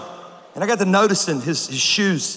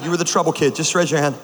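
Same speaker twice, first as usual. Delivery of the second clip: usual speaking voice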